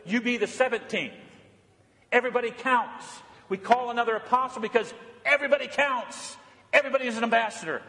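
high-pitched, driving sermon delivery; fervent preaching. Real, not acted. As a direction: preach it with urgency, pitch lifted. Climax of exhortation.

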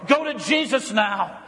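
ordinary talk, nothing marked

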